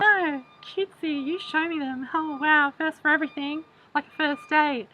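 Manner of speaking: very high, sugary put-on voice; mock cutesy reply. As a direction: play dumb and sweet, way up high. Her mock reply about penguins.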